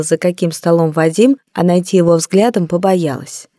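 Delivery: plain reading voice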